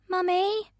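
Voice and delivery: put-on high little girl's voice calling out; child calling. A narrator's reading. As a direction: call from the next room like a small child. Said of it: "Mina's voice calls from the sitting room".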